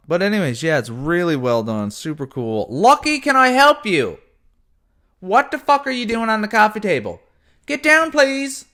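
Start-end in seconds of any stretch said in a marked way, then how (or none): 2.7-8.7 high, loud pet-scolding voice; scolding the cat. Real, not acted. Scolding cat on coffee table.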